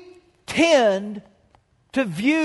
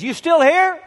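raised, high call into the storm; 8 semitones higher, 5 dB louder than usual. Retold or imitated. Husband calls into the driving rain, loud. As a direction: shout it across the yard.

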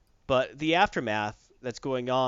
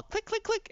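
mock clicking. Imitating a dial being turned.

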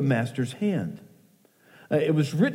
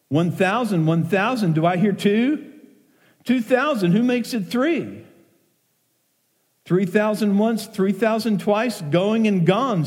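excited auctioneer; 6 semitones higher than usual. Acted. Auctioneer calling rising bids to a stunned room, excited. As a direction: build the excitement with each bid.

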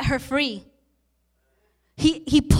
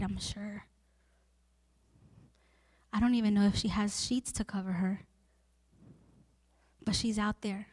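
much softer, gentle sermon voice; tender hushed preaching; 5 semitones lower, 13 dB quieter than usual. Acted. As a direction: lower the voice and draw them close.

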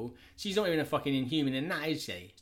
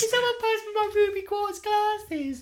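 much higher, slow, hammed-up whiny voice; mock Cyclops; 4 dB louder, slower than usual. Friend echoes Cyclops begging for his glasses in a high mock whine. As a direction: squeak it out like a prissy hero.

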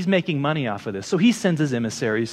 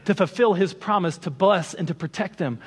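rising preaching voice; 5 semitones higher than usual. Acted. Preacher urges brothers and sisters, raised preaching. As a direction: lift it higher and press forward, preaching to the congregation.

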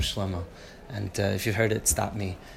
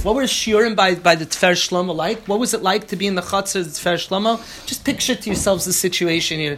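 louder, higher, driving lecture voice; fired-up preaching; 9 semitones higher, 8 dB louder than usual. Acted. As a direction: preach the rebbe's greatness with rising fervor. Fired-up Torah class.

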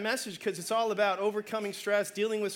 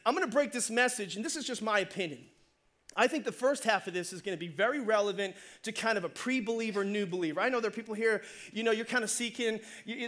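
ordinary delivery

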